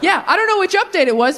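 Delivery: usual speaking voice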